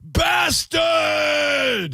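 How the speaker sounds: high shouted dying curse; overacted death scene. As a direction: yell it like a dubbed kung fu villain. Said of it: "they would always call you a bastard".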